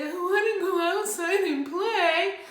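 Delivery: put-on high, loud childish whine; whining. Shown as a demonstration. Parent demonstrating to kids what whining sounds like. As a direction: whine it like a kid begging. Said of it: "And whining sounds like this".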